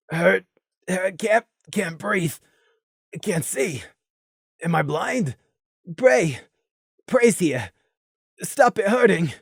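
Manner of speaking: a lightly voiced weak, hurting murmur; faint words from a wounded pony; softly voiced struggling plea; Gloom's voice, wounded. A narrator's reading.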